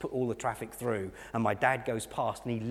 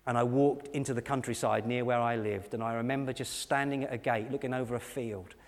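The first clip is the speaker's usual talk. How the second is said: normal talking voice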